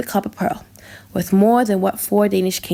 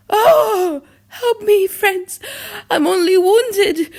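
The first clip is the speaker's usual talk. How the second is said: panicked plea; 9 semitones higher, slower than usual. Acted. Stabbed king pleads, crying.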